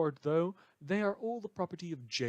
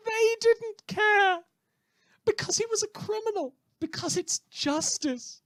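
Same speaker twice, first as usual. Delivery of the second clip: manic disbelief; 8 dB louder than usual. Acted. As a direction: stretch it out, giddy and bitter. Manic mocking after laugh.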